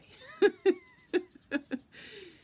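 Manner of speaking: high, loud, pulsing cackle; mocking cackle. Being answering a plea of why, mocking cackle. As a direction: laugh loudly in derision.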